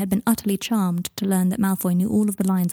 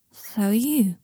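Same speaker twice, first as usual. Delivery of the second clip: breathed; slower than usual. A narrator's reading. Harry, drunk and close, breathing a whisper. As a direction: breathe it close to his face. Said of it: "breathed Harry".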